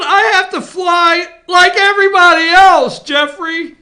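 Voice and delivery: high, loud whiny shout; mock wailing senator. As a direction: wail like a spoiled wife. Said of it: "Why are you crying".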